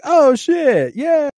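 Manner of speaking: high, loud excited yelp of recognition; delighted surprise. Retold or imitated. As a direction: burst out when she pops up on screen. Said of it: "I was like".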